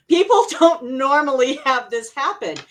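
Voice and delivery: real laughter running into the words; laughing while talking. Real, not acted. Laughs at her own strange story.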